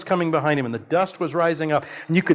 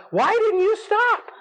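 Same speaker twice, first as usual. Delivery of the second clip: high, slow, frantic yell; retold yell; slower than usual. Husband yelling at wife on the way to hospital, high frantic yell. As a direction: cry it out high and slow, frightened. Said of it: "I'm yelling at her".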